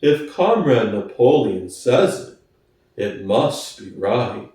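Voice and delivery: plodding, honest workhorse. Acted. Boxer voiced low.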